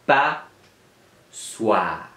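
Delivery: "Passoire" with a very long gap between pas and soire; split into syllables. Shown as a demonstration. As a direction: separate both syllables of "Passoire" for pupils. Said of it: "Dans la première syllabe ou la deuxième syllabe?". (« Passoire » en deux syllabes très détachées)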